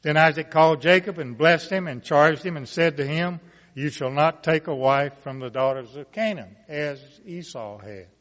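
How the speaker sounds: measured, rising Bible reading voice; scripture reading. Acted. Preacher reading Genesis aloud to the congregation, solemn and building. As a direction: read the blessing to the congregation, building.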